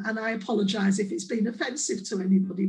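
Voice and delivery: ordinary talk, nothing marked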